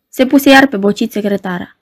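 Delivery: ordinary delivery, nothing marked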